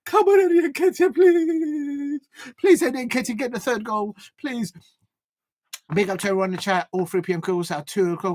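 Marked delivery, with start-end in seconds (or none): 0.1-4.7 pleading. Real, not acted. Pleading with player.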